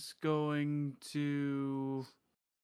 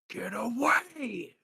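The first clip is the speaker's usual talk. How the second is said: high, half-voiced battle shout; mock roar held back in volume; pretend shout; 9 semitones higher, 4 dB louder than usual. Player voices Bess's Daunting Roar at enemies, mock shout. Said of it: "he's going to shout".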